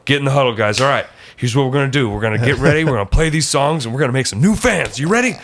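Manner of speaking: fired-up coach in a team huddle, pitch climbing high; hype man. Retold or imitated. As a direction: pump up the team, rising to a final hike. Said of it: "I could see you being the hype man".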